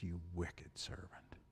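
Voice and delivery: slow, cold whisper; menacing whisper. Retold or imitated. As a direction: whisper the verdict slowly. King condemns the servant.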